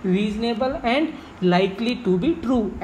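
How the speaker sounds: each word drawn out slowly for students; slow dictation. Shown as a demonstration. Teacher to students giving the definition, slowly dictated. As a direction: spell out the meaning slowly, word by word. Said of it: "तो plausible का मतलब क्या है?".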